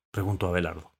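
plain reading voice

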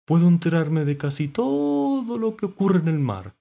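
high, loud, stretched character voice; knowing mollusc. A narrator's reading. Mollusc telling what it hears undersea, high and loud. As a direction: pipe up from under water.

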